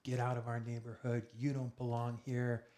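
retold yelling. Gangster ordering a stranger off the lawn, mock yelling. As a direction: imitate someone yelling to drive off an intruder. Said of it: "he starts cussing and yelling".

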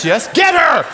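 loud, high-pitched excited yell; gleeful command. Retold or imitated. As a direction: shout it with acted delight. Jesus eagerly picking misfit recruit.

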